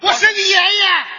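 high, loud comeback shouted at his partner; cheeky shout. Acted. Crosstalk partner shouts back a cheeky quip, loud and high. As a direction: bellow it to one-up the partner. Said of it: "上台没几句，".

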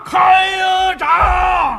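loud, high, long-held stage cry; executioner's shout. Acted. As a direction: stretch the command into an operatic shout. Executioner's call for the blade.

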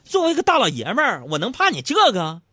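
mock-defiant high shout; yelling back. Husband standing up to wife's dare, blustering yell. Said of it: "我就跟他吼了".

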